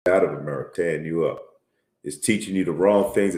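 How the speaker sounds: normal talking voice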